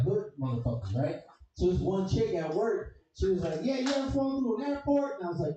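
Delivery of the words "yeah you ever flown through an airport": high, put-on voice of the coworker; lightly mocking coworker. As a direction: ask it like the chick at work. Coworker quoted in story.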